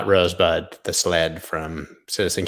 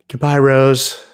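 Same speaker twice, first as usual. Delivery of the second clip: higher, louder hammed-up farewell; dying Jack; 6 semitones higher, 7 dB louder, slower than usual. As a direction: cry out a dramatic goodbye. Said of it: "That's me dying".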